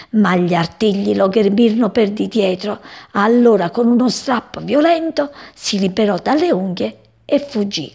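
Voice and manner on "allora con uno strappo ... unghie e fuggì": ordinary delivery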